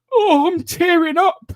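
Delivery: high, slow mock weepy voice; mock tearful. Dad mocking himself tearing up at the nativity, high and slow. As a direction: ham up getting teary at the play. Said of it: "I can, like".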